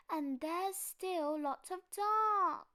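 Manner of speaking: high, slow frightened cub; little bear voice. Acted. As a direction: drag it out like a scared little cub.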